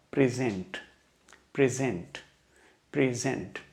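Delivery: Present repeated as pri-ZENT, second syllable stressed; measured pri-ZENT, weight on the end; verb stress. Shown as a demonstration. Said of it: "verb की तरीके से इस्तेमाल".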